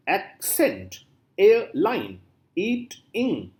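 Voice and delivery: "accent, airline, eat, in" slowly, beat by beat; split into syllables. Shown as a demonstration. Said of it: "all these words are the example".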